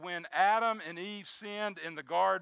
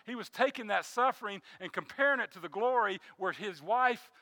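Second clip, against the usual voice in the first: ordinary talk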